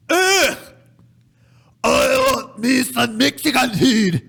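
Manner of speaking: high, loud, slow caricature Scot; true Scottish accent. Shown as a demonstration. Showing co-host a true Scottish accent, exaggerated Scottish. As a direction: bellow a hammed-up Scottish accent. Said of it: "If it was a true Scottish accent".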